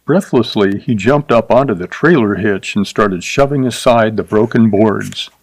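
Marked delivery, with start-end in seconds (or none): none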